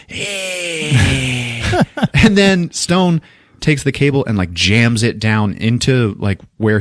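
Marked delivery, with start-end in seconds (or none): 0.1-1.6 long, thin creature screech; imitated monster screech. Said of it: "So it's like".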